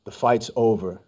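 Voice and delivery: a hint of a low superhero; Batman voice. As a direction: say it low and deliberate, close to his face. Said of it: "Did you say in Batman voice?".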